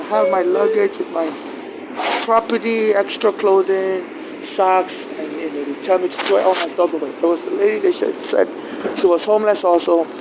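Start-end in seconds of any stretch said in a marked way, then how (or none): none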